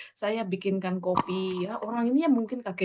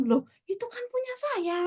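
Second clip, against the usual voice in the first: imitated protest; 14 semitones higher, 4 dB quieter than usual. Mother objecting to given-away Bible, high mock protest. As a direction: protest in a voice pitched way up.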